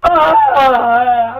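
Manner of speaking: loud startled cry; panicked yelp. Pretend. Hostage panics at spelling death.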